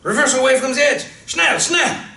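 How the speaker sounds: high, loud hysterical orders; panicked shouting. Acted. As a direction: yell in near hysterics. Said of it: "almost in hysterics as he shouts orders".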